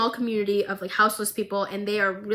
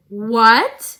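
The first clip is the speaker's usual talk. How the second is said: loud stunned "What?"; shocked outburst; 9 dB louder than usual. Retold or imitated. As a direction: blurt it in disbelief, louder. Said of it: "Both Victor and Jace go".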